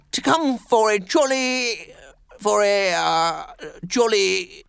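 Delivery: high jolly voice slowing and losing heart; faltering cheer. Acted. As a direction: falter and fall away, stumbling to a halt. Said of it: "His accents faltered and fell away".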